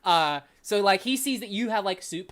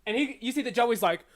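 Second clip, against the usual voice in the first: usual speaking voice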